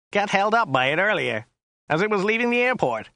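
high American grumble; disgusted. A narrator's reading. Driver grumbling about rhinoceros delay, mildly disgusted. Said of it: "said Joe disgustedly".

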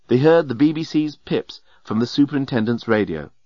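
ordinary delivery

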